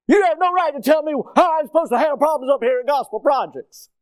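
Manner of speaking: high, loud indignant shouting. Acted. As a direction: shout back an angry protest. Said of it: "I lift my voice up like".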